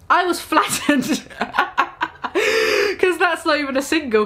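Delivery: breaking into giggles while reading; can't keep a straight face; laughing. Real, not acted. Reader cracking up at the story, laughing through words.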